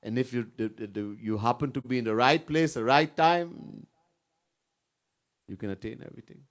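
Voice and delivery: normal talking voice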